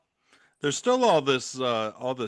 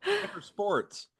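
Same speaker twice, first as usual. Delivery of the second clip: mock pity; 9 semitones higher, 6 dB quieter, slower than usual.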